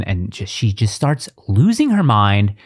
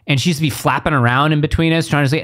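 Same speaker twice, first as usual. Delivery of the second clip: ordinary delivery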